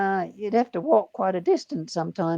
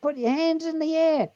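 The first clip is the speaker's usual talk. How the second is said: high, called-out cop command; mock police order; 6 semitones higher than usual. Police order the stopped driver out, high mock command. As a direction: call it out like an armed officer.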